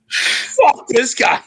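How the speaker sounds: slow, indignant mock outburst; pissed off. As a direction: act out being pissed at the guy, stretching the words. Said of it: "I was so pissed".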